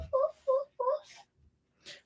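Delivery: high squeaky puppy woofs; dog bark. Pretend. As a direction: yip like a tiny puppy. Child barking along.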